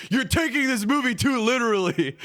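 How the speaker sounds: high, sneering mock of a snooty commenter; mocking reply. Mocking a Reddit reply that scolds a commenter, in a high sneering voice. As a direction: hold the Reddit reply up for ridicule in a high prissy voice. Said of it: "And the reply to that was".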